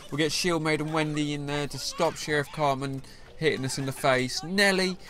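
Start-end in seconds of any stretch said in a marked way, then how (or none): 4.4-5.0 shocked disbelief, high-pitched; incredulous outcry. Real, not acted. Gamer reacting to the opponent's card Nelly, high incredulous outcry. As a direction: voice jumps way up on the name.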